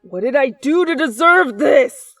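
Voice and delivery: loud furious rant to the sky; exasperated yelling. Acted. Frustrated boyfriend cursing his luck after losing her, loud. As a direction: yell at the universe in despair. Katsuki loses the girlfriend again.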